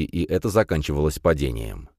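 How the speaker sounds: ordinary delivery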